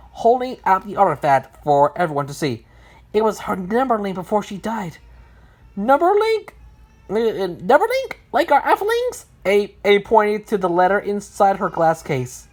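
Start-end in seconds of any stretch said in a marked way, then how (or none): 5.8-9.3 high character voice, rising questions; curious questioning. Acted. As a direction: query it high-pitched with a lift at the end. A asks about an unknown term.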